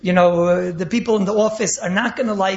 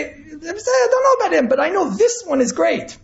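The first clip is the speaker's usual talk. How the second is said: much higher put-on voice for the advice; mock advisor voice; 8 semitones higher than usual. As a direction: play the tactful advisor in a bright put-on voice.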